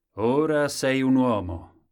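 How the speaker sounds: grand royal verdict; the king. A narrator's reading. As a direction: declare it slowly as a monarch. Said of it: "disse il re a Colombin".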